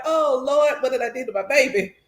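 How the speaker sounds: laughing mock lament. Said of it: "And I'm like".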